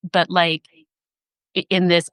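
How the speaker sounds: ordinary talk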